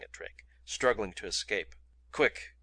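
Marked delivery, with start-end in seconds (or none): 2.1-2.5 gasped. A narrator's reading. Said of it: "gasped Ventnor".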